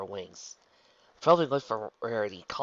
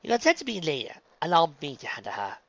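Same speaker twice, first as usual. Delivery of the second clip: higher, assured character voice; poised insistence; 6 semitones higher, 4 dB louder than usual. Acted. Rarity sending the twins away, politely insistent. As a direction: take over with polite authority.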